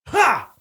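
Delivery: sudden loud, high "ha!"; mock triumphant "ha". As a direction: burst out with a mock gotcha shout. Acting Fester's triumphant pounce.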